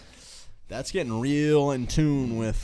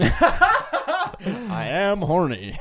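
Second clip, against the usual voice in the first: very slow, higher, drawn-out mock line; mock echo; 9 semitones higher, 3 dB louder, much slower than usual. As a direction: stretch it out teasingly. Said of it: "friend's mock echo".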